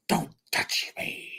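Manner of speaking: slow, unvoiced whisper; cat's touchy protest. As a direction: whisper it slowly as an offended cat. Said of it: "She's like".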